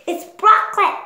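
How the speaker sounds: high, loud appalled cry; disgusted. Acted. Disgust spotting broccoli, appalled. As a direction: recoil in disgust at the food. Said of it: "Disgust, good taste".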